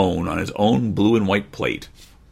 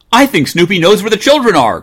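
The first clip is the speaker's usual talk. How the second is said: high, louder hopeful cry; eager cry; 10 semitones higher, 7 dB louder than usual. Acted. Mother realizing the dog knows the way, eager high cry. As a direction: cry it out with sudden hope. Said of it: "cried Mother".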